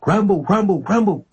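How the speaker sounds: high excited calls of the name; shouting. Retold or imitated. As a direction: call out eagerly like a thrilled kid. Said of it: "I shouted".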